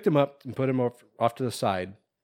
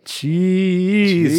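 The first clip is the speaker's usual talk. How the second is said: drawn-out silly call; 6 semitones higher, 5 dB louder than usual. Pretend.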